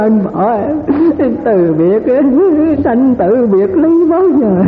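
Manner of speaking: hammed-up lament for a dead husband; mock widow's wail. Widow mock-crying over her husband's death, hammed-up wail. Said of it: "Cũng còn khóc".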